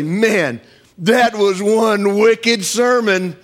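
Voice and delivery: slow, drawn out "wicked sermon"; mock slang compliment. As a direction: drawl it like an impressed young fan. Congregant compliment quoted.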